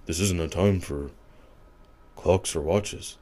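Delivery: mocking Johnny Depp. Retelling her ex's odd speech, lightly imitating Johnny Depp. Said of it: "how he talks is fucking hilarious to me".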